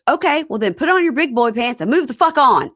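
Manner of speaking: acted exasperated outburst; retold scolding. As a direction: snap it out as an acted, exasperated order. Retold heated argument, scolding.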